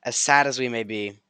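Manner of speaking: usual speaking voice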